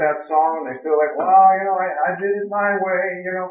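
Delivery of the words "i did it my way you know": normal talking voice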